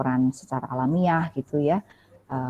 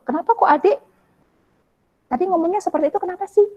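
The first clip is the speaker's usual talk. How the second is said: gentle asking; 13 semitones higher, faster than usual. Shown as a demonstration. Parenting expert showing mother how to ask child, gentle high voice. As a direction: ask kindly, like a patient mother. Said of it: "Ibu bisa tanya loh".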